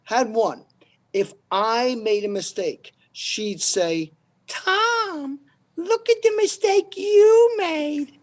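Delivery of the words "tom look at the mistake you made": high, slow mock boss voice; mocking the nagging boss. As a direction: mimic the bossy lady, nagging.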